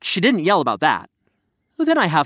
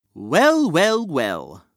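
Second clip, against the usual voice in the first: knowing well well well; much slower than usual. Acted. Narrator plays with the saying.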